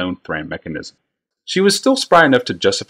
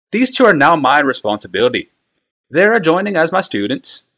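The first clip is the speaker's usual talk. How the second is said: higher, louder firm declaration; firm scholar; 9 semitones higher, 4 dB louder than usual. A narrator's reading. Tokasia overruling Bly, higher and louder. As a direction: settle the matter with authority.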